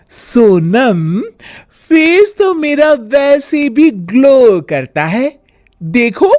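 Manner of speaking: high, vain scolding voice; brag, then wag a finger; boastful bahu. Acted. Bhabhi boasting and scolding Sonam, vain.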